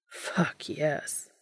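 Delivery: breathy whispered thought. Acted. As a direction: whisper it to yourself.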